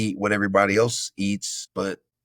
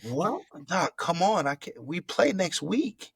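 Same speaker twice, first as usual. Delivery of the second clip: pleading high protest, much higher; disbelieving young player pleading with doctor; retold protest; 9 semitones higher, slower than usual. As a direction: beg the doc not to bench you. Protesting the doctor's broken-jaw news.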